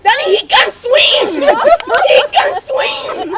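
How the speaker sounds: shrieking high and loud through laughter; slow, laughing, shouted disbelief. Real, not acted. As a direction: yell it out while cracking up.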